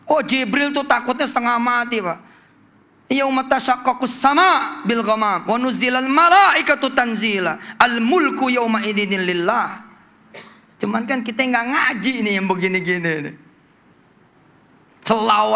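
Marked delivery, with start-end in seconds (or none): 3.1-9.8 Quran recitation. Acted.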